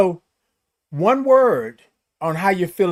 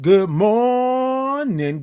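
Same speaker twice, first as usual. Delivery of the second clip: high, long-held "morning", stretched on the first syllable; big high stretched-out morning greeting; drawn-out greeting; 7 semitones higher, much slower than usual. Real, not acted. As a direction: sing out "good morning", holding "mor-" very long. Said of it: "I love all the O's and R's and I N Gs".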